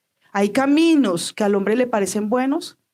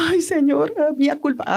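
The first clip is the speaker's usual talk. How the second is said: mock dismay; 5 semitones higher than usual.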